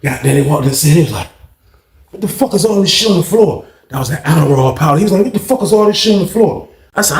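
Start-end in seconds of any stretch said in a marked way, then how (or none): 2.1-3.6 raised-pitch incredulous question; mock astonishment, pitched up; retold shocked demand. Imitates guard finding powder. 5.2-6.7 higher, incredulous guard question; mock bafflement at a raised pitch; retold shocked demand. Imitates guard finding powder.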